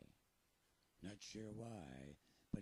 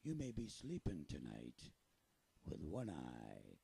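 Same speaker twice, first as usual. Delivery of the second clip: pirate voice. Acted. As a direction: talk like a salty pirate storyteller. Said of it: "Do you think I sound like a pirate?".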